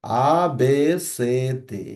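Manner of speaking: আ, বে, সে, দে said one by one, simple and clear; teacher chanting letters like for kids; childlike recitation. Shown as a demonstration. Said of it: "বাচ্চাদের মতো করে আমরা শেখার চেষ্টা করব".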